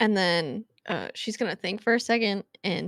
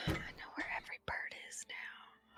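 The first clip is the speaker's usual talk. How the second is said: whisper; 17 dB quieter than usual. Acted. Player whispers in character.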